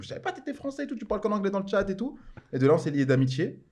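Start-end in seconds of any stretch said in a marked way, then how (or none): 0.0-2.2 a high squeaky take on his own younger self; lightly hammed-up retort in a raised voice; mock version of himself. Answering his friend on Skype, in a high mock voice. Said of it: "Moi, je dis".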